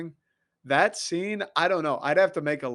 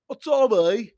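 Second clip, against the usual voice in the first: a loud, high London gangster imitation; Alfie Solomons; 7 semitones higher, 6 dB louder than usual. Fan imitating Alfie Solomons with a loud, high London accent. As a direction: bark it like a Cockney gangster. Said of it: "Alfie Solomons".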